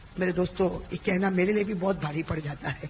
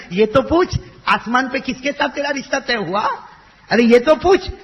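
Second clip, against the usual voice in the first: higher and louder, coaxing; smiling, eager; 6 semitones higher, 8 dB louder than usual. Acted. Prophet coaxes weeping daughter to ask, smiling and eager. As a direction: tease her to ask who. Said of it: "مسکرا کر کہا".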